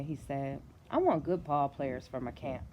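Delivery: plain reading voice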